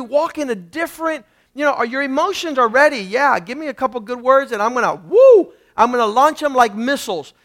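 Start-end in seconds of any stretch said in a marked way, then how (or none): 4.9-5.5 high, loud "woo"; mock fired-up holler; excited whoop. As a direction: let out a rising, hyped-up whoop. Mimics emotions ready to launch.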